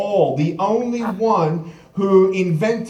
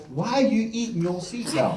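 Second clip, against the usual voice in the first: imitated bafflement; 2 semitones higher, 5 dB quieter than usual. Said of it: "she's looking at me like".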